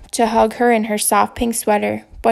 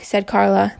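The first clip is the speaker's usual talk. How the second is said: plain reading voice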